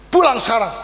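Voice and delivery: mock stern shout to get home now; harsh order. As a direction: drive them out like a demon. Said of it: "kayak usir setan saja".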